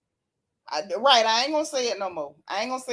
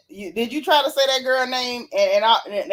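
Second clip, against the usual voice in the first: usual speaking voice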